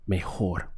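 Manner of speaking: mejor as meh-HOR, the J a soft breathy H. Shown as a demonstration. Teacher isolating the word mejor to show the J. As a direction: sound the J in mejor like an English H. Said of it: "Notice the H sound over here, over the J".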